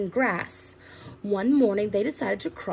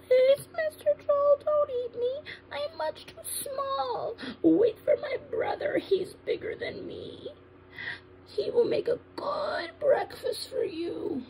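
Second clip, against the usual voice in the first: tiny, very high, pleading voice fading softer; frightened little goat; 3 dB quieter than usual. Acted. Little goat begs troll to wait for his brother, scared squeak. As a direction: plead in a squeaky, slow, small voice. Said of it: "was afraid, but all he said was".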